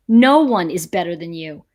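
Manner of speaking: ordinary talk, nothing marked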